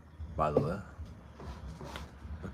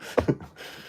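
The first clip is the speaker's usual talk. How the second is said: a short laugh on the breath; real laugh; breathy laugh; 5 dB quieter than usual. Real, not acted. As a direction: laugh it off at your own story. Laughs after the arrest story.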